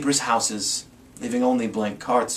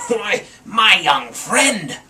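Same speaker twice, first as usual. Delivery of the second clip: slow, louder honeyed fib; lying fake Santa; 6 semitones higher, 7 dB louder, much slower than usual. A narrator's reading. Grinch as fake Santa fooling the boy. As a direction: drawl it out like a sweet-talking liar. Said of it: "the fake Santa Claus lied".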